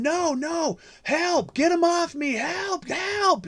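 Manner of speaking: mock screaming in a very high voice; high-pitched mock cries for help; retold woman's screaming. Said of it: "a woman screaming bloody murder at the top of her lungs".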